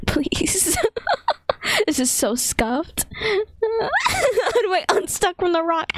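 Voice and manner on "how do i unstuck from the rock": high laughing wail, slowed down; laughing cry. Real, not acted. Player stuck in a rock, wailing through laughter.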